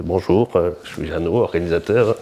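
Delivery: Belgian accent, badly imitated. Organiser introducing himself on the phone, rough Belgian accent. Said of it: "avec son accent belge".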